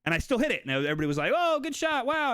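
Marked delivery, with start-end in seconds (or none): 1.3-2.3 high, excited mock praise from the hunters; imitating hunters cheering, much higher; impressed praise. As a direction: gush over the shot like a proud onlooker. Hunters praising her shot.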